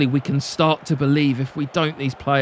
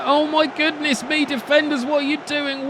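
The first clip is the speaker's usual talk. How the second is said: exasperated; 11 semitones higher than usual. Real, not acted. Defenders blundering.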